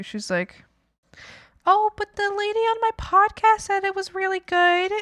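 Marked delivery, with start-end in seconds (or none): 1.7-4.9 high, defensive mom voice; mom imitation. Mom quoting her podcast lady, high and defensive.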